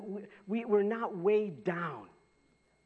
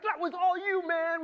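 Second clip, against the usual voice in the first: excited spotter; 12 semitones higher, 5 dB louder than usual. Retold or imitated. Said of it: "He's like".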